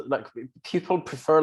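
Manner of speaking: usual speaking voice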